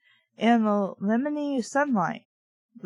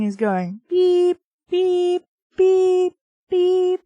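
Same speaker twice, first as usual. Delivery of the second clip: mock reversing scooter beeps, high and loud; beep, beep, beep like a backing-up scooter; playful high mechanical beeps; 8 semitones higher, 6 dB louder, slower than usual. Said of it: "like it's in one of those little scooter things".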